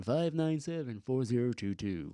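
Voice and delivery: low smooth radio announcer read. Shown as a demonstration. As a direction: read the numbers like a DJ. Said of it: "That's that radio voice".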